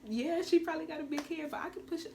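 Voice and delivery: rising to a high, loud, cocky peak; mock cocky self-talk in the delivery room; hyped-up retort. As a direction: psych yourself up, defiant. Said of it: "it's hyping me up".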